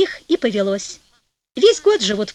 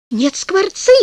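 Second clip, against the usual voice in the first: высокое упрямое возражение жены; возрази упрямо, повысив голос; заспорила; 7 semitones higher, 3 dB louder, slower than usual. A narrator's reading. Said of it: "заспорила жена". (упрямо, высоким голосом)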